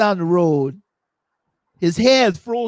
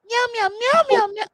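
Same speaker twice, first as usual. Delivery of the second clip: high, yowled cat meows; yowling cat. Pretend. Host imitates the raging cat with high yowled meows. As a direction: yowl like a cat in a rage.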